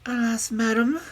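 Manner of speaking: high, tearful princess voice. A narrator's reading. Princess greeting the old woman, tearfully. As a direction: plead as a princess near tears. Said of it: "with her tears in her pretty eyes".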